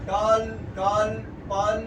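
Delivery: ডাল, গাল, পাল drawn out loudly, each ending in dark L; ডাল, গাল, পাল as loud teaching examples of dark L; dark L demo. Shown as a demonstration. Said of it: "ডার্কেল".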